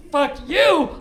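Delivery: loud mock shout; retold yell. The stranger on the street, quoted, shouting it loud. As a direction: throw it at him like the stranger did. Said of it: "And so when he says".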